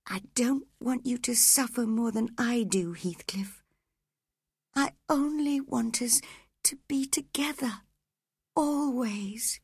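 high, hushed, partly whispered plea; dying woman whispering her wish; whispering Catherine. Acted. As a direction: soothe him in a faint whisper. Catherine pleads.

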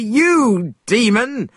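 high-pitched, forceful threat; Monkey's threatening cry. A narrator's reading. Monkey threatens a suspected demon, crying out high. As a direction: cry it out high, as a fierce warning. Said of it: "cried Monkey".

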